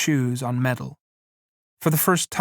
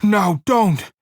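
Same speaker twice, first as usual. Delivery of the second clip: high, louder angry command; Lenny angrily orders the woman to stop yelling; 7 semitones higher, 5 dB louder than usual. A narrator's reading. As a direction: snap the order at her. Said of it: "Then Lenny grew angry".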